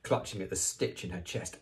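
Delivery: plain reading voice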